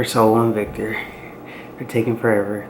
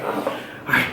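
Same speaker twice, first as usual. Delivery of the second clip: whispered; 6 dB quieter than usual. Real, not acted. Bracing before the prank.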